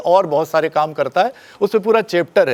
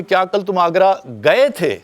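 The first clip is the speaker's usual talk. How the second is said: slow question with गए set apart and pitched up; stress on गए. Shown as a demonstration. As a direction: lean hard on गए, then fall on थे. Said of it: "मतलब गए थे कि नहीं गए थे".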